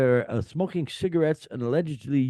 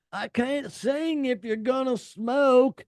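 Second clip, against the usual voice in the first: put-on a botched British accent, slow and high; attempted British accent, came out Southern; 12 semitones higher, slower than usual. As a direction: go for British rock singer, land Southern. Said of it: "that was Southern accent more than British".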